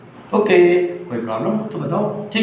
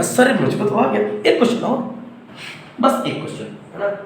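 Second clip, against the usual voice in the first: high, eager pupil asking for one more; eager student voice; 7 semitones higher than usual. Retold or imitated. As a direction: plead like a keen child for another question. (उत्सुक बच्चे की ऊँची आवाज़ में)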